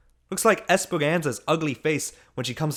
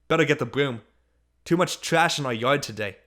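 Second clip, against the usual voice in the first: plain reading voice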